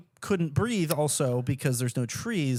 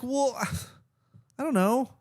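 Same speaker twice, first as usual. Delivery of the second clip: sheepish shrug; 9 semitones higher than usual. Retold or imitated. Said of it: "And I'm like".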